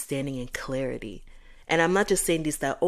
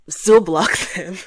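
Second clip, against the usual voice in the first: laughing; 3 semitones higher, 12 dB louder, slower than usual. Real, not acted. Laughing advice to block someone.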